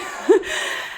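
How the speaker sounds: quiet airy laugh; little laugh to herself; soft breathy laugh. Real, not acted. Laughing at own joke.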